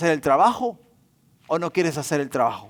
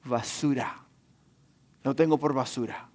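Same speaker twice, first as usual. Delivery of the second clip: solemn drop; 5 semitones lower, 7 dB quieter than usual. Retold or imitated. Preacher drops for the key word.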